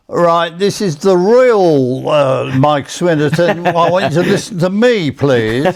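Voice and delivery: slow, high, insistent impression; Mike Swinnerton. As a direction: imitate an indignant boss insisting he's the real one, drawn out. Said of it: "Swinnerton come back on the phone".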